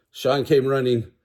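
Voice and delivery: usual speaking voice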